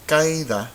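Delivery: caída squashed into two syllables, CAI-da; wrong diphthong pronunciation. Shown as a demonstration. Teacher showing caída without the tilde, wrong diphthong. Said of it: "this would be pronounced".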